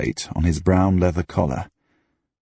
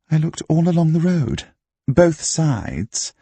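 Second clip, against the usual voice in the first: higher-pitched character voice, affronted self-defence; indignant Diane; 8 semitones higher than usual. A narrator's reading. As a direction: protest your innocence in a raised pitch.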